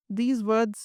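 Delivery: usual speaking voice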